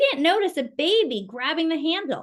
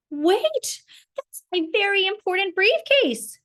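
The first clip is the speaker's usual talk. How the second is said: high, louder plea to stop; calling out; 8 semitones higher, 4 dB louder than usual. A narrator's reading. Mister Brown calling after the ice cream seller, high loud plea. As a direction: call after them, pleading. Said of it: "called Mister Brown".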